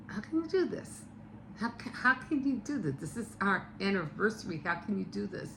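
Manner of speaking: high-pitched, softened reproach; high, pleading mock outrage; upset wife. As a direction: imitate a hurt wife confronting her husband. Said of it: "really, really pissed".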